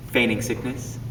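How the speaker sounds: ordinary talk, nothing marked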